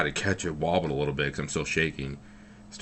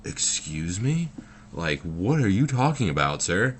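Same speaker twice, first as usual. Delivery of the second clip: raised pitch, drawn-out first question, then louder; acted-out disbelief; 4 semitones higher, 4 dB louder, slower than usual. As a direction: question a customer in mock astonishment. Retold disbelief at husband's remark.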